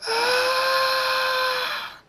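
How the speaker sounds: long, loud, very high scream; acted scream. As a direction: scream at the top of the range and hold it. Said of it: "continues to scream".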